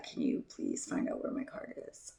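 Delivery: soft, low polite plea; very calm. Retold or imitated. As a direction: ask it hushed, holding yourself in check. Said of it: "I'm talking very calm".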